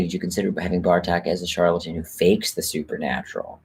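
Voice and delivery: ordinary delivery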